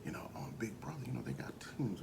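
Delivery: soft hushed scheming; scheming whisper. Retold or imitated. Queens plotting the alliance in a hushed whisper. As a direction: plot in a whisper. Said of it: "they're like".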